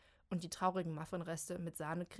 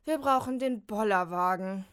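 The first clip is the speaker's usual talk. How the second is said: loud, very slow, exhausted groan; 2 semitones higher, 10 dB louder, slower than usual. Acted. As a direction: gasp it out after hauling. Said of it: "stöhnt Molly". (erschöpft stöhnend)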